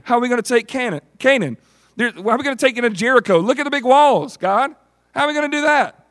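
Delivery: high, fast disbelieving cry; incredulous Joshua. Acted. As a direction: balk at the big walls. Joshua doubting the plan.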